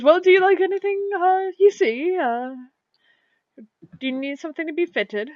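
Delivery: high, quick salesman patter; eager shopkeeper. Acted. Shopkeeper eagerly offering clothes to customers, high and quick. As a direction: pitch it up, eager to sell.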